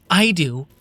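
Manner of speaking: high, loud interjection; blurting out. Acted. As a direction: cut in sharply. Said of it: "Mia spoke up".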